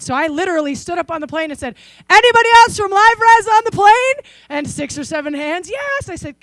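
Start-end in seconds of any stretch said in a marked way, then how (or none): 2.1-4.1 loud high call across the plane cabin; standing up and shouting across a plane. Retold or imitated. Calling out on the plane. 5.7-6.1 high sung-out yes; imitating passengers answering up high; mock chorus answer. Hands raised, passengers answer.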